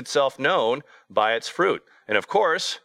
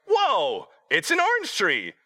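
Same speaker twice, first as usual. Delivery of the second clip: much higher, wide-eyed wonder at an orange tree; amazed kids; 10 semitones higher, slower than usual. Retold or imitated. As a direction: imitate the kids gasping in awe. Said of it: "They're like".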